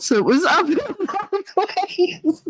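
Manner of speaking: high, slow, dissolving into laughter; words swallowed by giggles; real laughter. Real, not acted. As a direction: lose it laughing mid-sentence. Repeats joke, collapses laughing.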